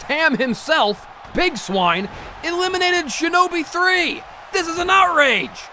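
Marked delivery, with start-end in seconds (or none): 1.3-5.7 high, shouted outrage at the result; outraged shout. Acted. Commentator outraged his favourite was eliminated, shouting high. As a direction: erupt in fury at the elimination.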